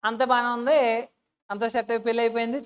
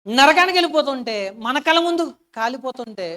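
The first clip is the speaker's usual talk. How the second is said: impassioned preaching; 2 semitones higher, 6 dB louder than usual. Acted. Preacher building to climax.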